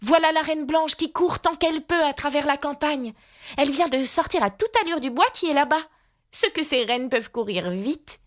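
put-on high, eager girl's voice; excited exclamation. A narrator's reading. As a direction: report an amazing sight, pitched right up. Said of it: "s'écria-t-elle en tendant vivement le doigt".

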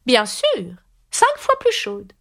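very high and slow on cinq fois plus chaudes; Red Queen insisting. A narrator's reading. Red Queen lecturing Alice, in a very high slow voice. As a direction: stretch it out high and superior.

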